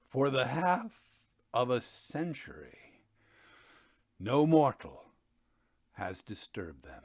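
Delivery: measured, grave storyteller's narration; dramatic story reading. A narrator's reading. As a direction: read it like a gothic tale by candlelight. Reads Poe story aloud.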